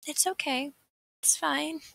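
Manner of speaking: high sing-song it's fine to a friend; imitating a kind friend, lifted high; soothing reassurance. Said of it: "you're just like".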